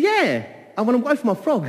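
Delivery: high, loud and stubborn; insistent high demand. Acted. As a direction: she won't take no for an answer. Wife insisting to husband.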